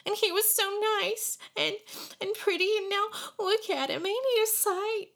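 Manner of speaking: put-on high, tearful little girl lamenting; a child pointing out the battered boy. A narrator's reading. Tearful girl to Jack about the beaten boy, high girl voice.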